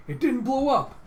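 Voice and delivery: high, loud disbelief; astonished shout. Retold or imitated. Man watching from outside sees no blast, astonished shout. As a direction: exclaim it loudly, caught off guard.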